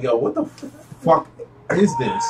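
halting, with pauses between words; retold bafflement. Retelling his first bite of veggie burger, halting and baffled. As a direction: stop dead before the swear, puzzled.

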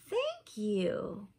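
plain reading voice

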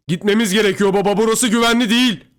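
urgent high, loud warning; shouting. A narrator's reading. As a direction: shout a plea to someone far off. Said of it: "diye bağırdım". (tiz ve telaşlı bağırarak)

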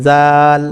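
জাল drawn out with a light, thin z; tongue to upper teeth tips, hold the aa in জাল; thin letter sound. Shown as a demonstration. Teacher asking learners to copy, thin long জাল. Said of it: "যেমনটা আমি বলছি".

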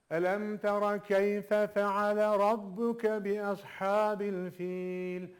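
slow chanted recitation; Quran recitation. Shown as a demonstration. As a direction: recite the verse slowly, with tajweed. Teacher recites verse. (yavaş tecvidli tilavet)

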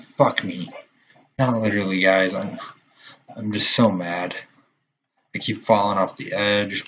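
ordinary talk, nothing marked

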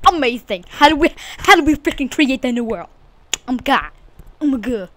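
loud, high excited shout; over-the-top excited yelling; hyped. Acted. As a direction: yell it all hyped up. Hyped up gamer.

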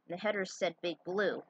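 usual speaking voice

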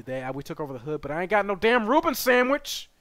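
ordinary delivery

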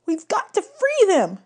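put-on high, slow goat-boy voice; high satyr voice, urgent; Grover voice. A narrator's reading. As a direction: plead it high and earnest.

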